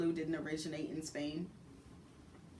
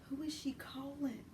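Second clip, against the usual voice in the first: high whisper; hushed anxious question; whispered worry; 7 semitones higher, 7 dB quieter than usual. Retold or imitated. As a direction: whisper it nervously to yourself. Worried private aside.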